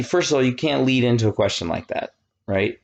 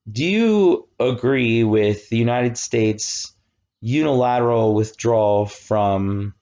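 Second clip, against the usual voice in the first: slow, complicated poll question. Shown as a demonstration. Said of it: "then I'll give you a hard one".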